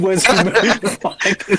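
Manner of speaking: high, slow, laughing disbelief; laughing question. Real, not acted. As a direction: ask it through a laugh. Laughing at the rhino's size.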